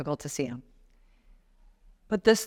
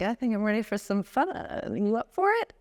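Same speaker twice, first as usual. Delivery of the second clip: playful invitation; 4 semitones higher than usual. Pretend. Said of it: "you're like".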